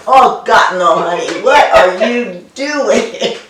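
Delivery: exasperated German dad scolding from the grave; German accent. Retold or imitated. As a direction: late father's German accent, fussing over the brand. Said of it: "rolling over in his grave going".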